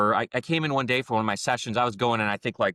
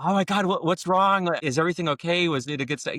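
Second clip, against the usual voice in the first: retold alarmed rush, higher voice; freaked-out manager; 6 semitones higher than usual. Manager asking the customer if something's wrong, alarmed. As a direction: mimic a manager panicking over a complaint. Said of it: "he freaked out".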